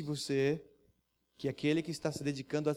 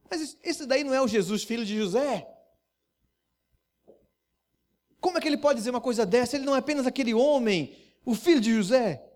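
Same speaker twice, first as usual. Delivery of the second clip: higher, louder, rushing indignation; scoffing disbelief; 7 semitones higher, 6 dB louder than usual. Acted. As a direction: sneer at the claim.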